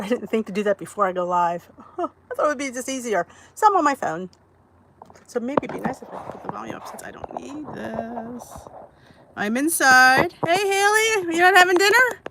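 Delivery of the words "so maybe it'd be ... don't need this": whispered aside. Real, not acted. Muttering aside while adjusting phone.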